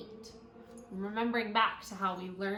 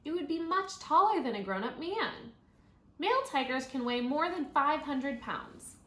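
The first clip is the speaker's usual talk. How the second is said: bright, clear read-aloud voice; reading to children; 5 semitones higher, 4 dB louder than usual. Acted. Teacher reading a tiger book to her class, clear read-aloud.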